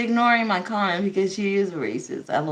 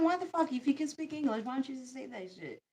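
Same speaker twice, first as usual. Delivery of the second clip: ordinary talk, nothing marked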